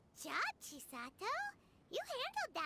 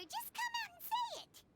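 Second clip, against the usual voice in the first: sharp and high, calling the bluff; indignant retort; 11 semitones higher than usual. Acted. Companion snaps back at the suspicious guard, indignant. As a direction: throw the challenge back at him.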